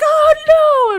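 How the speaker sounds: high, loud panicked yelp. Retold or imitated. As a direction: squeal it like a fleeing guest. Guest sprinting past screaming.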